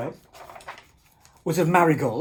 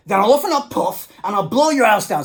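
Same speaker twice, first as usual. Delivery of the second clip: a hint of a menacing pig, louder; big bad pig threatening the wolves, louder; 3 semitones higher, 9 dB louder than usual. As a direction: growl out the threat. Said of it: "said the pig".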